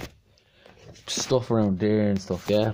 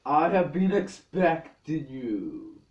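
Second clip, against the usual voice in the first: slow, higher dramatic villain voice; booming echoing villain monologue; 4 semitones higher, much slower than usual. Acted. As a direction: drawl it like a movie villain. Sudden villain greeting to the camera.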